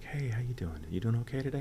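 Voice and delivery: hushed, playful imitation of the director; sly, knowing greeting. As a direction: act innocent when you both know.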